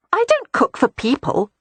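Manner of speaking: breezy and pleased with herself; cheerful. A narrator's reading. Beatrix cheerfully defends her dog biscuits to her brother. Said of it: "Beatrix said cheerfully".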